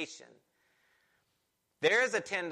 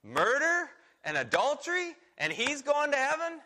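put-on incredulous old church lady; 8 semitones higher than usual. Pastor quoting a shocked church lady, raised pitch and scandalized. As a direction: mimic a shocked Wesleyan lady's disbelief. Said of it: "she was good old Wesleyan lady".

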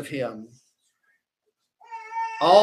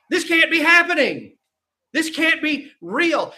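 high, stunned, with a nervous laugh; a disciple who can't accept it, slowing down; panicked disbelief; 10 semitones higher, slower than usual. Acted.

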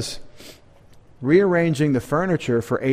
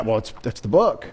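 usual speaking voice

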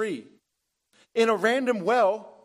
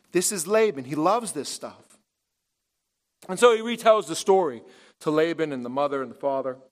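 ordinary talk

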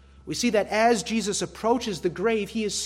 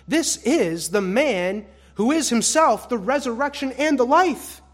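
loud, raised proclamation from the pulpit; ringing sermon declaration; emphatic preaching; 5 semitones higher, 8 dB louder than usual. Real, not acted. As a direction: proclaim it forcefully, building to the last words. Preacher proclaims at full force.

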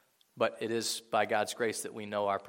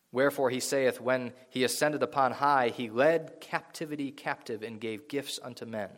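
rising, louder preacher's reading of scripture; 5 dB louder than usual. Acted. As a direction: proclaim the verses to the congregation. Reads Ephesians passage aloud.

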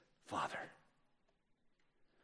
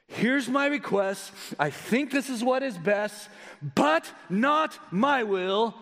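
raised, higher voice with BUT and NOT punched apart; emphatic contrast; 9 semitones higher, 3 dB louder, slower than usual. Acted. Preacher voicing Jesus's request to God, emphatic BUT NOT. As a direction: state it firmly, then hit BUT, slowly, NOT, MY WILL. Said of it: "he gives an emphatic capital B".

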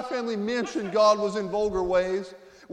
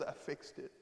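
softly spoken, much quieter; hushed; 6 semitones lower, 16 dB quieter than usual. Retold or imitated. Jesus tenderly telling Adam, hushed and soft. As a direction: say I fixed it barely above a breath.

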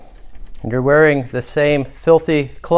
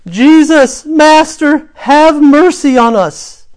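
loud, high drawn-out cry for mercy; lifted-up voices; 9 dB louder, slower than usual. A narrator's reading. Ten lepers call to Jesus from afar, crying out loudly. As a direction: call out from a distance, much higher and louder. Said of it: "lifted up their voices".

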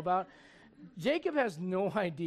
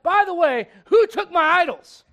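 hammed-up furious shout; angry Laban; 9 semitones higher, 13 dB louder than usual. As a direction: bellow the accusation like a furious father-in-law. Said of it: "Laban gets there all mad".

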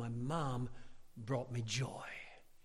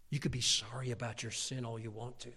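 ordinary talk, nothing marked

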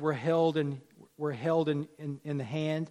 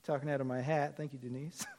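ordinary talk, nothing marked

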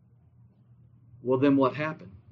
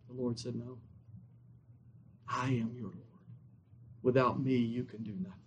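low, very soft, solemn delivery; hushed preaching; 6 semitones lower, 16 dB quieter than usual. Real, not acted. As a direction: drop right down for the Lord's answer. Hushed sermon telling of God's answer.

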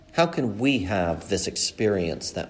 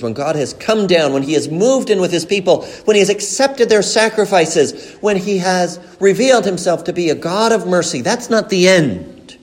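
rising, louder, impassioned preaching; preaching at full force; 8 semitones higher, 8 dB louder than usual. Acted. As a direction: build each clause higher and louder. Builds to preaching climax.

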